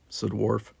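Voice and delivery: usual speaking voice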